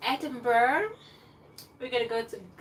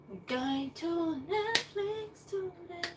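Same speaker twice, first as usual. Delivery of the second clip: higher, slow, put-on voice; sing-song; 8 semitones higher, much slower than usual. Real, not acted. Vlogger declaring her Netflix plan, sing-song. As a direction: announce it grandly.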